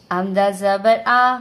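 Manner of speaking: slow, loud letter-naming; spelling drill. Shown as a demonstration. Teacher begins spelling a word, slow loud drill. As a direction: sound out the letter and vowel with measured weight. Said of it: "ہجے کی مشق بھی کریں گے".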